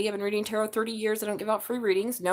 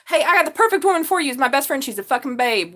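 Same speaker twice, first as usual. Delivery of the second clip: louder, higher eager matchmaking; excited pitch; 5 semitones higher, 9 dB louder than usual. Real, not acted. A tarot reader pitches her best friend to a single dad, loud and excited. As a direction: sell her friend with gusto.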